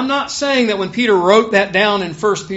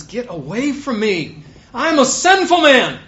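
louder and higher on I'm a sinful man; not quiet; 5 semitones higher, 6 dB louder than usual. Retold or imitated. Peter falling at Jesus' knees, crying out loud. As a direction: cry it out in a loud rush. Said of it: "no point that he just says this in some quiet way".

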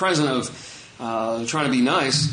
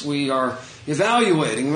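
ordinary talk

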